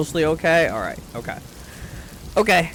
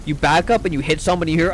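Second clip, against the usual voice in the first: plain reading voice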